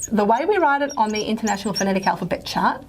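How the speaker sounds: ordinary delivery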